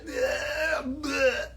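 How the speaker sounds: high, silly voice of a fan going bleh; mock bored fan. Mock viewer groaning bleh at the streamer. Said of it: "what you guys are probably doing".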